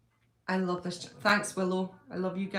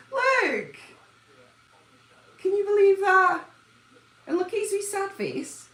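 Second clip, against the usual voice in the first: much higher and louder, amused outrage; disbelief; 11 semitones higher, 6 dB louder than usual. Real, not acted. Owner showing viewers what the dog left of the bear, high loud disbelief. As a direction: show off the wrecked toy in mock shock.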